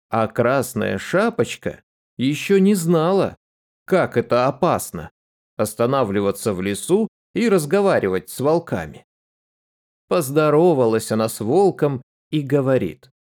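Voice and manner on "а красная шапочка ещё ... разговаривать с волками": plain reading voice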